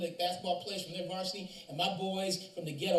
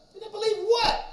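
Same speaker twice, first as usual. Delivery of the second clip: much higher and louder, puzzled and urgent; baffled question; 9 dB louder than usual. Retold or imitated. Teacher asking the crying student what he means, high bewildered question. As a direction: ask it high and startled. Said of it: "I'm like".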